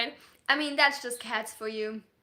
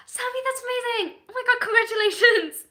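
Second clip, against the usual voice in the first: excited; 10 semitones higher, 10 dB louder than usual. Real, not acted. Cheering a viewer's new job.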